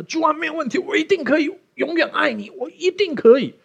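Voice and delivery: high, hammed-up boastful promise; fake bold vow. Believer faking confidence before God, high mock bravado. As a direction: overpromise to God with false bravado. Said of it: "很假装的".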